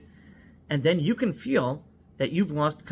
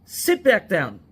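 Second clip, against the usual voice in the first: loud, higher barked command; commanding order; 5 semitones higher, 7 dB louder, slower than usual. Retold or imitated. Police commander ordering the husband to sit, loud command. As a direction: order him back into his seat. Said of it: "stands up even bigger and makes, you know, a big pose".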